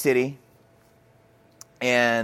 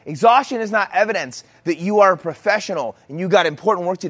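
raised, higher preaching voice; emphatic preaching; 5 semitones higher, 4 dB louder than usual. Real, not acted. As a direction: hammer each point home to the congregation. Preaches against the lie of exhaustion.

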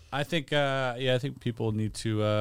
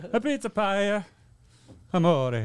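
mock Italian; 9 semitones higher, slower than usual.

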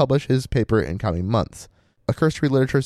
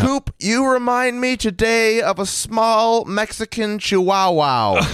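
Gordon Cole impression; 11 semitones higher, slower than usual. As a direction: bellow it slowly like a half-deaf boss. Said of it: "spoke always like, Agent Cole from Twin Peaks".

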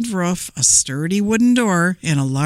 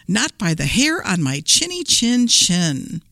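ordinary delivery

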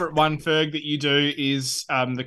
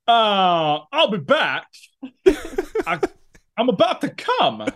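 halting, high-pitched celebrity double impression; Christopher Walken doing Arnold Schwarzenegger; 7 semitones higher than usual. Podcast host performing a requested joke impression, Walken playing Schwarzenegger. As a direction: drop the catchphrases in with long halts, voice pitched up. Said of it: "how would it sound if it was Christopher Walken doing, doing Arnold Schwarzenegger doing it".

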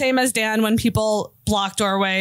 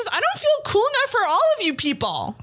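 high-pitched confession; 13 semitones higher than usual. Real, not acted. Confessing insecurity, raised pitch.

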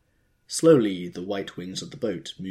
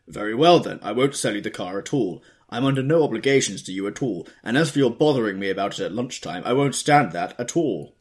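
loud, higher, rising annoyance; annoyed; 4 semitones higher, 9 dB louder than usual. A narrator's reading. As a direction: snap it down the phone, fed up. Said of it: "rose high with annoyance".

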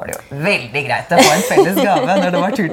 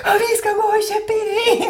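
very high, manic mock squeal; hysterical shriek. Woman re-enacting her manic phone call to family, hysterical shriek. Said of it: "skreket helt hysterisk".

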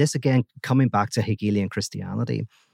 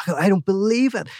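much higher, louder, stammering; amazed disbelief; 10 semitones higher, 3 dB louder than usual. Acted. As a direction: blurt it out, overwhelmed by luck. Seamus wins again, amazed.

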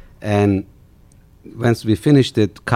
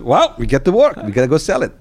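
higher, louder, cheerful can-do voice; imitating Kyle's upbeat optimism; Kyle's optimistic voice; 9 semitones higher, 6 dB louder, faster than usual. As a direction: play the unstoppable optimist. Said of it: "This is how Kyle sounds".

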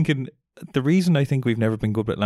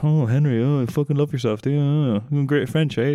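mocking classmates. Classmates slagging Henry for his good French accent, mocking. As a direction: taunt him like a jealous classmate. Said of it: "Just getting him".

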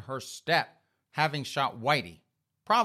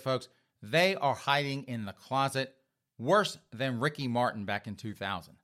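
ordinary talk

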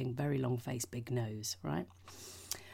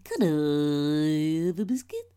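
slow, louder stretched question; weird drawn-out delivery; 8 dB louder, slower than usual. Retold or imitated. Callback to the friend's strange biscuit line. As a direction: drag it out like the friend did.

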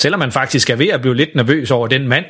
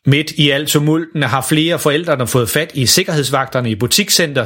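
ordinary delivery

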